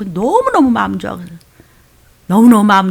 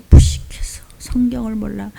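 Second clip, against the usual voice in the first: whispered verdict. Real, not acted. Preacher explaining arrogance, whispered verdict. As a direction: whisper the answer sharply.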